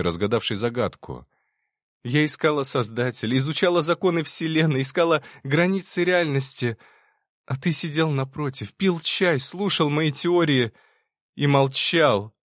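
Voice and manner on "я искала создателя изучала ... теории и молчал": anguished accusation, high and intensifying; building to near cry. A narrator's reading. Girl accusing the creator who kept silent, building almost to a cry. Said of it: "Последнее слово прозвучало почти криком".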